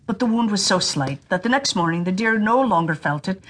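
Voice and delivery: ordinary delivery, nothing marked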